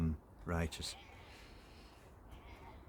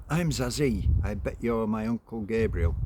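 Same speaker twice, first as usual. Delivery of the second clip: put-on child's voice; 4 semitones higher, 7 dB louder than usual. Child voiced greeting uncle.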